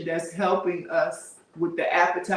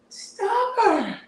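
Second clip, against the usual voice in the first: Stubborn said high and drawn out; a high exasperated "Stubborn"; stressed repeat; 10 semitones higher than usual. Real, not acted. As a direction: lean hard on "Stubborn". Repeats word for emphasis.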